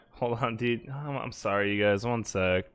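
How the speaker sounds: low, subdued apology; deflated apology. Real, not acted. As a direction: apologize softly to the viewers.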